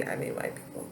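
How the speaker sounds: whispered, pointed aside. Real, not acted. Pointed aside after naming majority.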